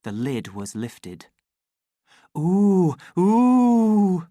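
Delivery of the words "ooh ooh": high, loud, drawn-out ooh of delight; a joyful crowd's rising cooing cry. A narrator's reading. Crowd greets the opened cash box, cry of joy. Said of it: "in a single cry of joy".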